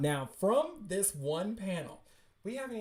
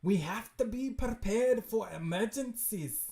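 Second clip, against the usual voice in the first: high, loud put-on voice; insistent character; 6 semitones higher, 6 dB louder than usual. Character lecturing Harlan on readiness, loud and high. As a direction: insist loudly in a raised character voice.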